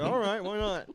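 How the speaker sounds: high, loud cheery shrug; mock carefree workers. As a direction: toss it off like a happy worker paid in beer. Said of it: "They're like".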